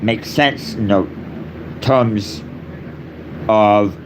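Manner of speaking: usual speaking voice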